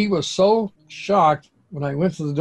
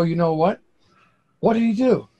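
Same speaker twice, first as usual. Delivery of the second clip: ordinary talk, nothing marked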